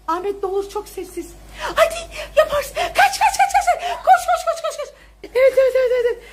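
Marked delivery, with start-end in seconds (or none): 1.8-6.2 excited cheering. Real, not acted. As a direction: cheer the runner home. Cheering child on during chase. (yüksek sesle gülerek tezahürat)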